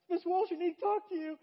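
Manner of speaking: high, loud mock kid voice; child plucking up courage. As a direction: pipe up to the teacher like a nervous schoolkid. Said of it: "I worked up the courage".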